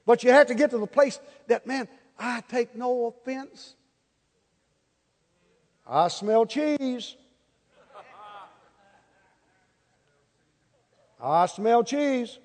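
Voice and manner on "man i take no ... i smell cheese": slow mock calm. Acting calm forgiving believer.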